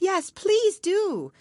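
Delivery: very high, slow crowd cry; squeaky bird chorus. A narrator's reading. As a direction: chime in as many small birds. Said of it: "joined in chorus".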